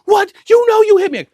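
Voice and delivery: frantic, very high yell; imitated going crazy. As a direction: mimic an outraged man shrieking. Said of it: "he's going, cra".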